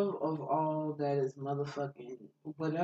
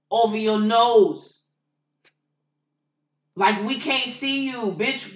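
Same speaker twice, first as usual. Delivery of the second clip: higher, louder and slow, spelling it out; exasperated rant; 6 semitones higher, 6 dB louder, slower than usual. Real, not acted. Worker scolding customers who come in without masks, exasperated. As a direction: lecture them like they should know better.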